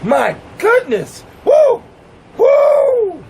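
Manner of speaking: high-pitched whooping with delight; hammed-up high, loud pleasure whoops; man in ecstasy. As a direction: whoop far above your own voice. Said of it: "he'll be like".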